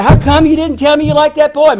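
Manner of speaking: loud, high, indignant demand; pissed-off demand. Retold or imitated. Son calling his father, angrily demanding why he never said so. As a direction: confront him with the grievance, loud and hurt. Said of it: "I was pissed".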